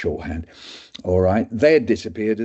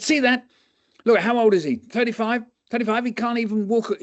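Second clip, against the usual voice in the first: much higher, incredulous; can't believe the age; astonished doctor; 13 semitones higher than usual. Retold or imitated. Doctor marvels to students, high.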